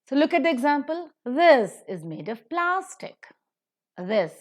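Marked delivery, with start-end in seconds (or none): 1.2-3.3 slow, this loud and lifted, of weak; accent this, made and plastic; stress on this, made, plastic. Shown as a demonstration. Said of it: "is accented".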